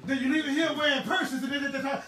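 ordinary delivery

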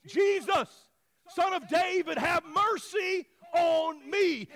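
loud, high drawn-out plea; screaming out. Acted. Blind beggar calling to passing Jesus, screaming out. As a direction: cry out for mercy over a crowd. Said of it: "so he screams out".

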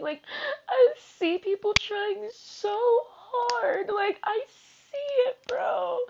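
high-pitched, tearful recounting; real tears. Real, not acted. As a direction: keep talking through the crying, voice climbing. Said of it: "That's why the tears came so quick".

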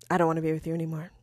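low, soft and quick imitation; retold quiet admission. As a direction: drop the voice and get it out fast. Retells boyfriend's breakup line.

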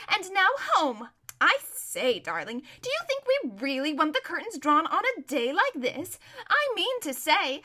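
gushing, self-absorbed chatter; spoilt society lady. Acted. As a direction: object gaily to closed curtains.

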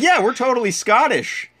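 high imitation of mom. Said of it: "She's like".

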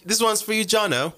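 high, hammed-up British accent; a put-on British posh voice for Jono. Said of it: "break into my British accent".

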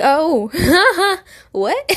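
high, loud "oh" breaking into laughter; giggly, high-pitched astonishment, retold; stunned laughing reaction. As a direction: reenact shocked disbelief, laughing through it. Retelling her shocked reaction to news.

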